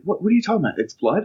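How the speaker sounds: high, startled questioning; mock alarm at the word blood; surprised cop. Cop asking about the blood, high startled questioning. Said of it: "He's like".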